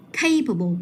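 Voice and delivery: capable with CA stressed, the ending falling; stress on first syllable. Shown as a demonstration. Teacher modelling for a student, capable stressed on CA. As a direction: say capable leaning on its opening CA. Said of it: "mình sẽ nhấn vào âm tiết số một".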